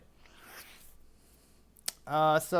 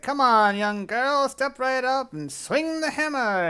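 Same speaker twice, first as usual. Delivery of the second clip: high, loud, drawn-out carnival call; big elephant man calling a player up; goading carnival barker; 9 semitones higher, 6 dB louder, slower than usual. Acted. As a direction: goad them on like a fairground showman. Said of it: "he's goading you on".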